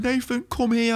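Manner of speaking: high, tearful mum voice imitated; mum in tears. Son retelling his crying mum's phone call, tearful and high. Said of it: "she's in floods of tears".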